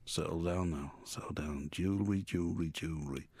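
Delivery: low, soft, half-whispered mumble; a lightly voiced Jimmy Savile, dropped low and hushed; Jimmy Savile impression. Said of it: "Can't do the accent".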